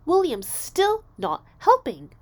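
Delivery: high, loud, slow whine; exasperated tattling. A narrator's reading. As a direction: drag out a fed-up complaint, loud and high. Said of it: "Jamaica reported again".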